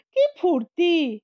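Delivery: ordinary delivery, nothing marked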